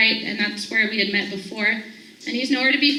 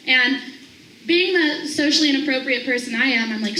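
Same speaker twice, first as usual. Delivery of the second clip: usual speaking voice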